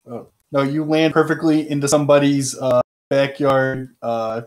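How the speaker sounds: normal talking voice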